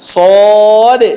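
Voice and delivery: সদে held long, ending in a little push on দ; bounced final dal. Shown as a demonstration. Teacher explaining the ending, long সদে with bounced d. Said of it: "দাল হওয়ার কারণে ধাক্কাটা লাগছে".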